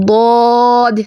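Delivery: ضاد said long and full-mouthed; ضاد with a heavy, drawn-out ḍ; Arabic ḍād, held long; ḍād sound demo. Shown as a demonstration. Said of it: "ادائیگی اور تلفظ دیکھتے ہیں".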